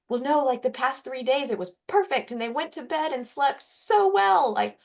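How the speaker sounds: exasperated parent, pitched high; high-pitched, pleading frustration; worked up. Acted. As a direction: act out a baffled parent getting worked up. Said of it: "you get so worked up".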